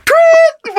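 high, piercing "tweet"; sudden high whistle imitation; imitated referee's whistle. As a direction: mimic a whistle blast with the voice.

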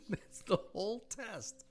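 high slow mocking voice; mocking repeat. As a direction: parrot it back in a silly high voice.

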